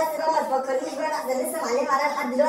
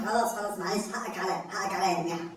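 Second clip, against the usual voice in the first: meek apology; 8 semitones lower, 7 dB quieter than usual. Acted. Backing down, apologizing.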